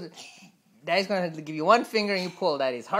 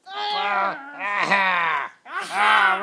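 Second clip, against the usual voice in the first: mock straining cry; much slower than usual. Baby pulls dad up.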